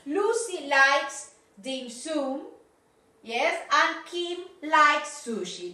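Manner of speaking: each name and likes said slowly; teacher reading example sentences; slow model sentences. Shown as a demonstration. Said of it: "For example".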